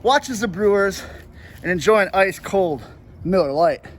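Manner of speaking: punchy, loud beer-ad read. Acted. Host opens with a beer spot, loud announcer read. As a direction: belt it out like a sponsor spot.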